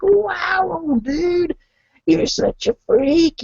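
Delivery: very high, drawn-out exclamation; hammed-up high-pitched wow; mock amazement. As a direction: marvel at him in a high, stretched voice. Acted amazed reply to old dude.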